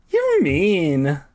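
a bit high, playful tease. Acted. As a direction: tease lightly. [name]'s high voice, first take.

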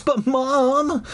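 high, whiny protest; crying out. A narrator's reading. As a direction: protest to Mom in a high cry. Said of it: "I cried".

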